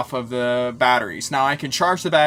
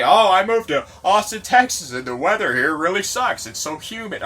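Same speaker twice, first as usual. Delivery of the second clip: whiny newcomer; 3 semitones higher than usual. Retold or imitated. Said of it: "Everybody on Facebook loves to say".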